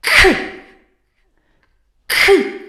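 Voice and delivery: "K" snapped high and loud like a knock. Shown as a demonstration. Teacher demonstrating the knocking k. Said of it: "is knocking down".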